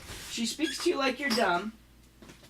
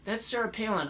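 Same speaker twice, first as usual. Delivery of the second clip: usual speaking voice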